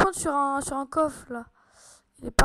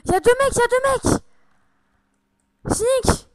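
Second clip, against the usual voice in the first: higher and louder, urgent call-out; excited alert; 7 semitones higher, 8 dB louder than usual. Real, not acted. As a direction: shout the warning to your team. Spotting enemies mid-game. (alerte excitée)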